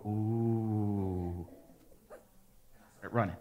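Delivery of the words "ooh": low, soft, drawn-out "ooh"; crowd's "ooh". Retold or imitated. Onlookers watch two friends about to fight, ominous "ooh". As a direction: imitate onlookers sensing trouble. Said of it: "I can hear everyone go".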